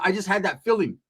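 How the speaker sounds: usual speaking voice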